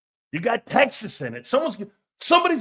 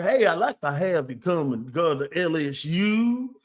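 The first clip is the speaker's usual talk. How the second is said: fake Cajun accent. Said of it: "phony-ass accents".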